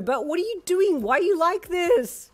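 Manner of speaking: high loud screech. A narrator's reading. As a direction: screech in disbelief. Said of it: "you screeched".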